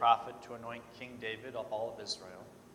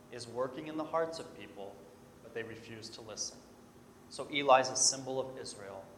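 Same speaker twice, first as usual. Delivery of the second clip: ordinary talk, nothing marked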